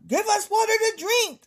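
high, loud demanding voice; demanding shout. Acted. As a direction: yell it like a thirsty crowd. Reading Israelites' demand in voice.

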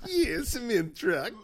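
slow mock foreign-accented voice; Borat. Trying out the requested bad impression, Borat. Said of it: "bad impression of Borat".

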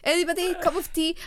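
high, loud chirpy offer; British tea offer. Retold or imitated. Housemate offering tea to everyone, high and cheery. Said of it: "So British".

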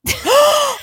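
sharp high gasp; shocked gasp. Real, not acted. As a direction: gasp in disbelief. Shocked listener reaction.

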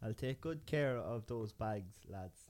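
mock Boards Mill accent. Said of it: "do it in your proper accent now".

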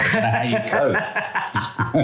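high, slow through laughter; apologising to Carlitos for his mum, laughing. Real, not acted. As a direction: crack up while apologising.

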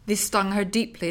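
plain reading voice

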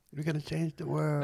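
quietly mocking, sarcastic praise. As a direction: sneer at the activists. Sarcastic mock of activists.

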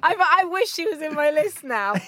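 high, loud, laughing through it; real amused laughter in the voice. Real, not acted. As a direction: say it while cracking up. Quizmaster laughing at the joke.

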